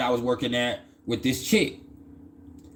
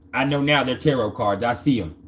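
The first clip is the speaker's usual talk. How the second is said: normal talking voice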